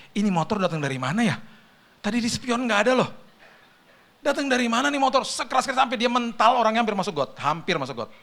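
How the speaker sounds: high, loud bewildered outburst; baffled disbelief. Retold or imitated. Driver just hit by a motorbike, baffled disbelief. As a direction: replay the shocked questioning, pitch climbing. Acting out shock after crash.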